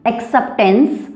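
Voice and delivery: slow acceptance, rising on tense; teacher's slow demo of acceptance with an upward lift; rising sound. Shown as a demonstration. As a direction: stretch acceptance and tip the end upward. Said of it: "थोड़ी सी ऊपर की ओर उठ रही है".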